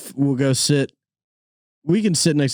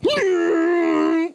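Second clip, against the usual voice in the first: high rising panicked gasp; startled gasp back. Retold or imitated. Driver startled by her gasp, gasping back. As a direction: gasp as if bracing for a crash. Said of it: "that noise will make me go".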